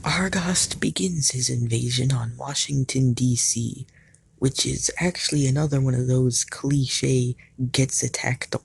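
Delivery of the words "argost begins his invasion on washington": ordinary talk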